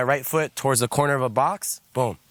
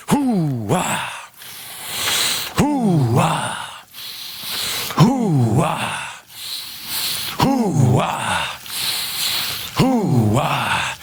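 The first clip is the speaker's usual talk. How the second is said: breathy chant; much slower than usual. Shown as a demonstration. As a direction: chant hoo-wah with each step, on the out-breath. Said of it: "Now we're gonna add the breath".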